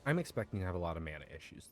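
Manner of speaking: ordinary delivery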